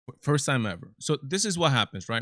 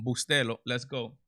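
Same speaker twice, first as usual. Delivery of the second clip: ordinary talk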